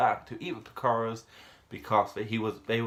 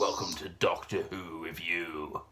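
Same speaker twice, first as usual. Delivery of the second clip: low, slow title announcement; announcer intro; 5 semitones lower than usual. Acted. Reviewer opening Doctor Who Review, low slow announcer voice. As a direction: intone the show title low and drawn out. Show title after sung theme.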